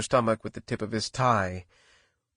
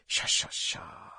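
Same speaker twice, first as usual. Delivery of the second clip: slow, whispered shushing; long, unvoiced comforting sha-sha; soothing hush; 7 dB quieter, slower than usual. A narrator's reading. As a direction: softly hush a baby. Ruth hushes and comforts the baby.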